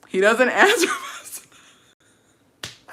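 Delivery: laughing. Real, not acted. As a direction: crack up while telling it. Laughs while retelling the delay.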